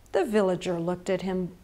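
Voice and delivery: ordinary delivery, nothing marked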